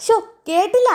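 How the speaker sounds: high-pitched deaf tea seller asking again; deaf old shopkeeper. Acted. Deaf tea shop owner voiced. (ചെവി കേൾക്കാത്ത ചായക്കടക്കാരൻ്റെ ഉയർന്ന ശബ്ദം)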